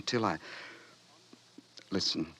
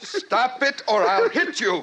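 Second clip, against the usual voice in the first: angry threat; 11 semitones higher than usual. Acted. John threatens mocking Bernard.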